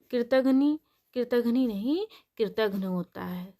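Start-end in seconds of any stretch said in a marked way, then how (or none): none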